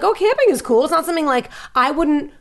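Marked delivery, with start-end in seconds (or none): 0.0-0.8 high, casual excitement; high excited quote. Retold or imitated. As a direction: chirp it out brightly. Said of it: "I just was like".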